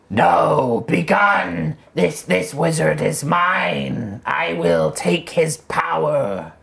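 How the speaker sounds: slow, raspy evil spirit. Acted. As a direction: rasp it out like a demonic spirit. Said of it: "you hear a raspy voice come from the tower".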